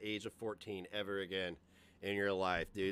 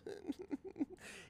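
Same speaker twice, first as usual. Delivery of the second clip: imitating weak villain laugh; 10 dB quieter than usual. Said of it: "all he can muster up is a".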